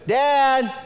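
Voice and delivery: put-on loud high call of a child from bed; child calling dad from bed, loud. Child calling, louder each time.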